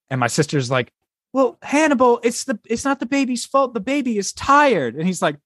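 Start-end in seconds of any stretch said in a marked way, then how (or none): 1.3-5.0 high-pitched mock sister making excuses; pleading sister. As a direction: plead the baby's case in a much higher voice. Voices sister excusing baby in joke.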